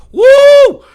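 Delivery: loud high whoop of exasperation. Real, not acted. Venting at the groundskeeper, loud high whoop. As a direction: let out a loud exasperated woo.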